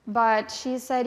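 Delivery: normal talking voice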